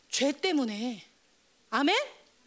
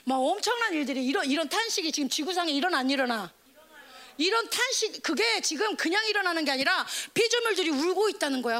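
normal talking voice

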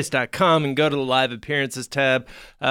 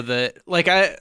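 ordinary delivery

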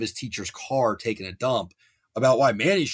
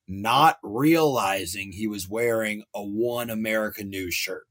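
ordinary delivery

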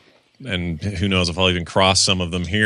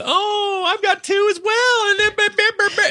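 chatty stranger. Retold or imitated. Imitating an overeager stranger who has kids too. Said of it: "they come up with".

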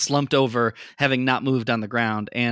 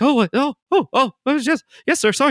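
much higher, louder flustered stammer; flustered Leon; 12 semitones higher, 5 dB louder, faster than usual. Acted. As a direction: stammer an apology, caught out. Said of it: "Leon's like".

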